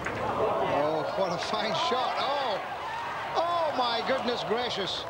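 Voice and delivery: rising thrilled golf call; excited. Real, not acted. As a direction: marvel at the shot, pitch climbing. Commentator thrilled by great shot.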